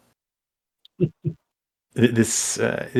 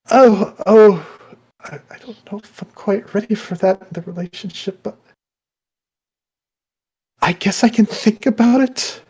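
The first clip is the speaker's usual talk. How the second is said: high, hesitant and flustered; flustered woman; 7 semitones higher, 3 dB louder than usual. Acted. Woman hesitating over the suitor's proposal. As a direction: stammer it out, unsure.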